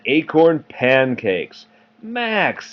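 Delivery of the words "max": much higher sister's call; Ruby calling Max. Acted. Parent voices Ruby teasing Max, high call. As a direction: draw his name out like a superior sister. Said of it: "said Ruby".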